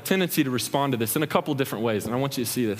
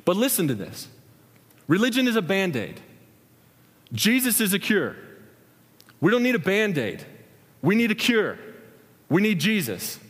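measured, emphatic sermon punchlines; preaching; 4 semitones higher than usual. Acted. Preacher urging the congregation toward Jesus, emphatic paced preaching. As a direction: drive each line home with pauses.

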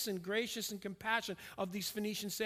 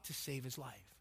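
softer, lower, drawn inward; hushed; 8 semitones lower, 10 dB quieter than usual. Real, not acted. Preacher to congregation on God's rescue, hushed. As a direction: drop to a near hush to land it. Quiet climax of the point.

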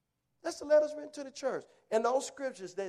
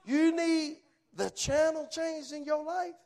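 normal talking voice

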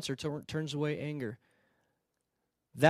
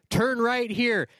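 slow, louder robotic GPS command; a hammed-up sat-nav announcing the turn; mock GPS voice; 4 semitones higher, 5 dB louder, slower than usual. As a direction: imitate the GPS ordering a turn. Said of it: "that thing says".

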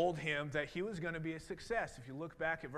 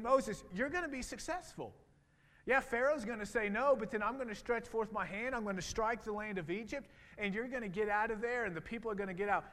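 higher, bolder voice of God rallying Moses; encouraging pep talk; 6 semitones higher, 3 dB louder than usual. Retold or imitated. God giving hesitant Moses a pep talk, higher and bold. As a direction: pump him up like a coach who believes in him. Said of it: "God gave him a little bit of a pep talk".

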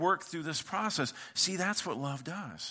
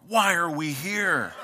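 slow, louder whiny complaint; whiny bored husband; 2 semitones higher, 7 dB louder, slower than usual. Retold or imitated. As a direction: drag it out like a bored husband dragged shopping.

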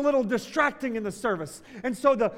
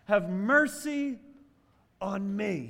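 loud cry for mercy; 3 semitones higher, 8 dB louder than usual. Acted. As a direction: cry it out desperately. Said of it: "He cries out all the more".

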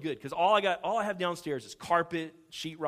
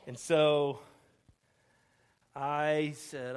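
ordinary talk

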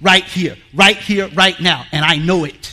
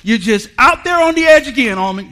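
weary God teasing; 6 semitones higher, 6 dB louder than usual. Retold or imitated. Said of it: "I bet God just gets sick of me".

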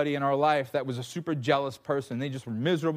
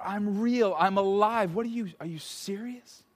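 hurt disbelief; 6 semitones higher than usual. Acted.

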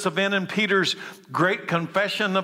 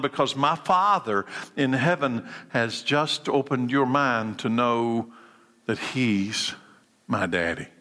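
tender hush; 6 semitones lower than usual. Real, not acted. Tender intimate line about God.

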